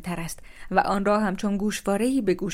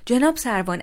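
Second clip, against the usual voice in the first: louder, higher whiny plea to the officer; playing the victim; 5 semitones higher, 6 dB louder than usual. A narrator's reading. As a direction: act wronged and innocent. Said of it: "با لحنی مظلوم نمایانه".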